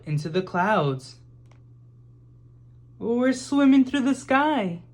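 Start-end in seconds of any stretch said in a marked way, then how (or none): 3.0-4.8 put-on much higher, louder girl's delight; thrilled girl, pitched up; excited child. A narrator's reading. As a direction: squeal it with wonder from the sky. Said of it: "said Em".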